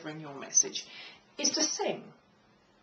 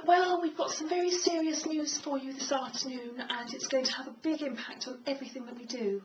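high, light demo voice; high-pitched voice; 5 semitones higher than usual. Shown as a demonstration. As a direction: deliver it in a raised, higher register. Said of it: "Do you have a high tone voice".

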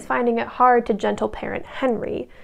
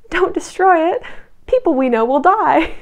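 high, loud heroic plea; mock-dramatic cry to stop them, pitched up; Belle; 5 semitones higher, 5 dB louder than usual. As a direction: plead loudly like an overwrought heroine. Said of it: "Belle's heroic move is to go".

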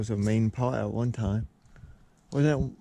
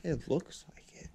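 whisper; 3 semitones higher, 7 dB quieter than usual. Real, not acted. Exploring abandoned school, hushed.

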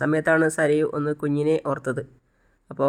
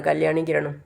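plain reading voice

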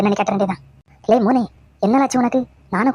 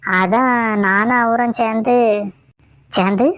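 slow, halting, drawn-out lament; slow mock sadness; slower than usual. Daughter-in-law slowly builds mock-tragic suspense to mother-in-law. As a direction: stretch each word as if confessing tragedy.

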